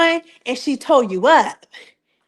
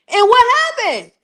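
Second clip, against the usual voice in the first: much higher, teasing the payoff; dramatic setup; 10 semitones higher than usual. Real, not acted. Vlogger building suspense about the reunion, much higher. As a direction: pitch the question up for drama.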